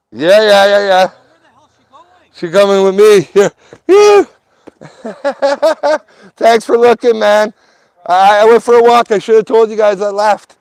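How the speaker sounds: high and loud, calling across to neighbors; loud high greeting. Real, not acted. He tells the neighbors loudly and high that he went for a walk. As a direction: project up to the neighbors.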